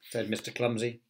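ordinary delivery

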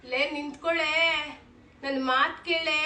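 loud call to stop. Acted. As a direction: shout to stop her leaving. Calling after leaving daughter.

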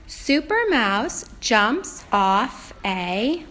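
slow, drawn-out storybook reading; reading to a toddler. Acted. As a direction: read each word slowly to a small child. Teacher reads the book aloud.

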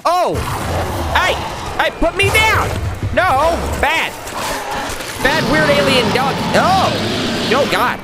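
high, alarmed cries; panicked protest. Real, not acted. Gamer grabbed by a weird alien dog, panicked protest. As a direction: yelp in short high bursts at an attacker.

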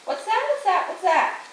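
high, quick repeated question; startled questioning. A narrator's reading. Jason waking to a sound at night, startled questioning. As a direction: squeak it out as if jolted awake.

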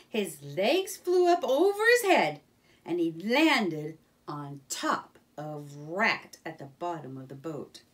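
ordinary delivery

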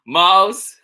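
put-on Mom calling her child, high and loud. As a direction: call the name up high, loudly. Mom calls to Mouse in story.

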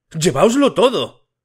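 father trembling as he empties the wallet; trembling rage. A narrator's reading. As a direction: shake with anger as he throws the money. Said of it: "murmuraba, temblando de pies a cabeza".

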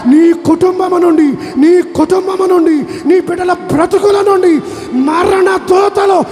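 building to a loud, high, slow proclamation; preaching at full force. Acted. Preacher proclaiming deliverance from death over the congregation. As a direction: roar the blessing over the crowd.

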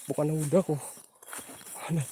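usual speaking voice

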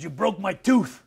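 hammed-up sore wrestler; imitating angry Hulk. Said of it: "And he was pissed".

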